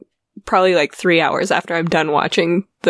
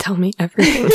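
hushed, eager gossip whisper; much faster than usual. Real, not acted. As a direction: lean in and whisper it.

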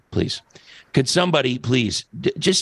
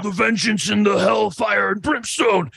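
Warrior promo; 7 semitones higher than usual. Retold or imitated. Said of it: "So Warrior comes out".

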